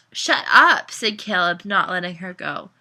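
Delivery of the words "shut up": high, loud cut-off; sharp retort. A narrator's reading. Caleb refusing to let Mariana go, sharp retort. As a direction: shut them down loudly.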